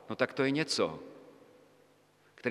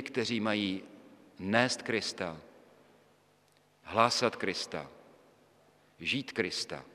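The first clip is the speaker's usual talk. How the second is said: fading to hushed; 5 semitones lower, 5 dB quieter, slower than usual. Real, not acted. Sermon climax fading to hush.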